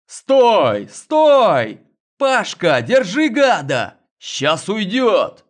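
loud chase shout. A narrator's reading. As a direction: yell after a fleeing thief. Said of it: "взорвался криком".

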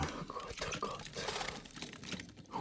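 barely audible, breathless whisper of dismay; despairing whisper. Acted. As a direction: whisper it as the ground gives way under him. Suspect cornered in interrogation. (verzweifeltes, kaum hörbares Flüstern)

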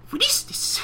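tiny, very high squeaky voice; put-on little falsetto, puzzled; Fluttershy. Voicing Fluttershy puzzling over toy turtle.

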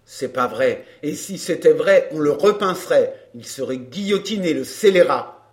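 low, loud, vindictive voice; angry man. A narrator's reading. Man wishing the scoundrel guillotined, angry. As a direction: insist in a low, forceful pitch.

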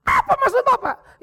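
high, loud and laughing in disbelief; indignant outburst. Retold or imitated. Wife to pastor, loud disbelieving outcry. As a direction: throw the question back.

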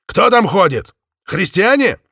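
loud, high shout into darkness; calling out. Acted. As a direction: call out across the streets. Calling out into the night.

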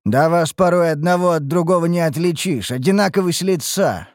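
teasing, slightly derisive retort; mocking jibe. A narrator's reading. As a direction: needle them with a light taunt. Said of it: "ответил я с издёвкой".

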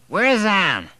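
put-on high, loud, slow drunken whine; thick slurred drunk. A narrator's reading. Drunk father demanding his dead wife, slurred and loud. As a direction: push the words out thick and slurred, too drunk to care.

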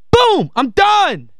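a sudden, high, excited shout of triumph; excited boom. Real, not acted. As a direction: punch it out like a swish. Celebrates his own point.

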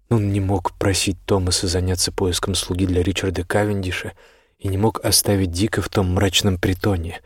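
plain reading voice

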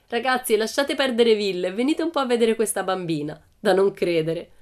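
loud, gleeful summons; jeering. A narrator's reading. Bengt inviting the boys to see this girl, jeering. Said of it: "un ghigno gli contrasse la faccia".

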